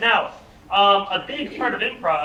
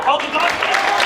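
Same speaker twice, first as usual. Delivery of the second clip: excited; 6 semitones higher, 6 dB louder, slower than usual. Acted. Acting excited about a dog.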